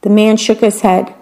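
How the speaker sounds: usual speaking voice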